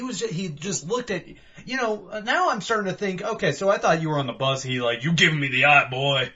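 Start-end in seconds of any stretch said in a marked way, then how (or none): 5.0-6.3 loud, menacing street-guy threat; mock-threaten him like a crazy stranger; hammed-up loud menace. Acted threatening bus stop guy.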